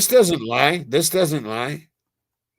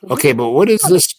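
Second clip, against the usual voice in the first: ordinary talk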